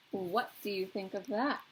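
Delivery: plain reading voice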